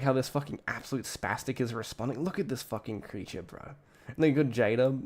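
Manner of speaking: usual speaking voice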